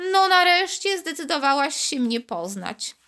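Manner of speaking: high little voice, mild grumble; grumbling little voice. A narrator's reading. Bead grumbles at Karolcia, mildly. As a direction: grumble at her, half-appeased. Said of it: "gderał głosik".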